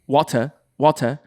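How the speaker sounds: slow upper-class British "water", R dropped. Shown as a demonstration. Showing friends perfect English, upper-class British "water". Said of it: "like, uh, upper class British".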